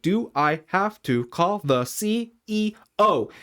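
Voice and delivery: slow and high, each of C, E, O set apart; deliberate, word-by-word challenge on "C E O"; slow, pointed repeat. Retold or imitated. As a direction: spell out C... E... O as a threat. Repeated after "Excuse me?.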